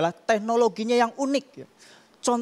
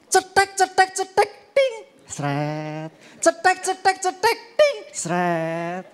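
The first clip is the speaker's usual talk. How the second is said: typewriter sounds; 10 semitones higher, slower than usual. Pretend. Comedian imitating a typewriter, high clicks and a ding.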